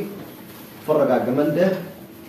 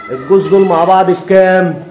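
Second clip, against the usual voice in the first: loud call-out; 3 semitones higher, 8 dB louder than usual. Real, not acted. Seller calls out price question.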